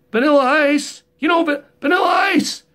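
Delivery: high, fast mock shout; calling out. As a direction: yell after him to get his attention. Calling after the rapper guy.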